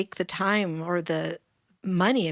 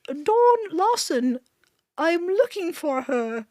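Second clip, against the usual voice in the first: much higher, slower, mimicking a woman calling out a name; high-pitched British voice; 9 semitones higher than usual. Guest imitates Natasha searching the crowd, high-pitched British voice. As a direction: squeak it out like a searching caller with a British lilt. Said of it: "It was very high".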